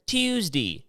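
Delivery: Tuesday as tuzdi, mock Baltimore vowel. Echoing his hometown, mocking Baltimore accent. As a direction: repeat Tuesday in a mild Baltimore drawl. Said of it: "What did you just say?".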